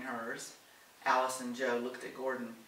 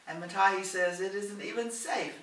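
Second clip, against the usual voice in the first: higher, louder scolding reminder; firm reminder; 6 semitones higher, 6 dB louder than usual. A narrator's reading. Doris reminds Helen to stay out of it, firm and raised. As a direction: shut the idea down emphatically. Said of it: "Doris reminded her".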